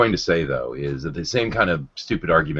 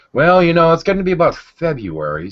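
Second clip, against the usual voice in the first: mocking; 12 semitones higher, 6 dB louder than usual. Mocking the predictable atheist argument.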